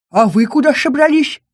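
high, loud, anxious squeak; worried creature. Acted. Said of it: "обеспокоенно дёрнул хвостом кошмар".

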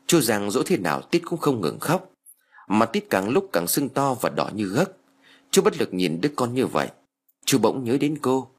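ordinary delivery, nothing marked

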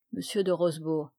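ordinary delivery

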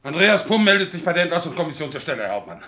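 high, loud, rapid report; military reporting. Acted. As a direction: report smartly to the captain. Soldier reports to his captain.

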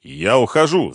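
irritated ex-husband; angrily. A narrator's reading. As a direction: announce it crossly. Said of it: "зло произнёс Ренат".

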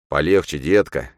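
usual speaking voice

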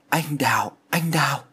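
put-on a fevered young man calling a name, voice cracking a little; mildly broken, delirious murmur of a name; strained voice. A narrator's reading. Delirious son calling a ghost's name, lightly strained voice. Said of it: "Giọng của Thiện lạc hẳn đi".

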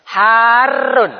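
"Harun" with the a held long and the r doubled, rising at the end; teacher showing "Harun" with a long a and a doubled r; lengthen then double. Shown as a demonstration. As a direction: stretch the "a" in "Harun", then press hard on a double r. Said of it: "dipanjangkan dulu, kemudian di tasdid".

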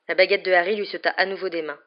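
ordinary delivery, nothing marked

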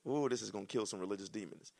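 ordinary talk

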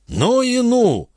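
very high, drawn-out amazement; astonished exclamation. A narrator's reading. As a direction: exclaim slowly in high-pitched disbelief. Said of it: "воскликнул барон". (изумлённое восклицание)

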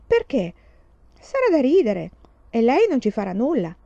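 high, loud, delighted; boy thrilled by mischief; gleeful boy. Acted. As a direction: laugh off the worry with glee. Boy excited about the prank.

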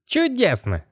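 high, delighted skeleton cry; excited exclamation. Acted. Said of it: "воскликнул он".

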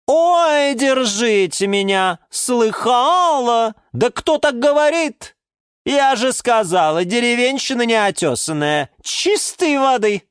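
high, drawn-out derision; snorting scorn. A narrator's reading. Orchid ridicules the geranium's speech, scornful. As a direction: scoff high, stretching the mocking words. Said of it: "фыркнула орхидея Галатея".

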